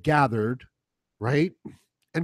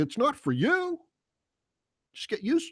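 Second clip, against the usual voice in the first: patronizing; 12 semitones higher than usual. Acted. Voicing teachers lecturing a young man.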